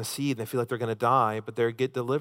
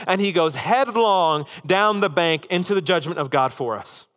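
raised, louder preaching voice pressing each point home; full-force preaching; 6 semitones higher, 6 dB louder than usual. Acted. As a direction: preach it hard, climbing higher and louder.

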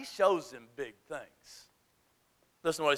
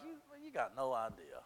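lower, much softer knowing reply; soft dismissive God; 9 semitones lower, 15 dB quieter than usual. Retold or imitated. God answering Elijah, low and quiet. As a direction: say it low and unbothered.

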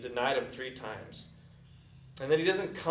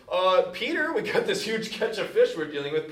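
raised, higher voice calling after him; exasperated fishermen; 7 semitones higher, 6 dB louder than usual. Retold or imitated. Disciples calling after Peter who jumped overboard, exasperated. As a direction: call after Peter, a bit fed up. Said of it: "other guys are like".

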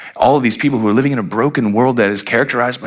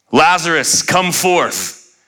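triumphant, raised-pitch command; Jesus; 5 semitones higher, much slower than usual. Acted. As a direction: proclaim it like a triumphant hero. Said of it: "like, triumphant Jesus".

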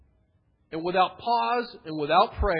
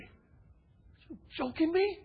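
much higher, slow, disbelieving Jonah; outraged Jonah; 10 semitones higher, much slower than usual. Retold or imitated. Jonah, furious that God spared Nineveh, slow and disbelieving. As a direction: stretch out his outraged disbelief.

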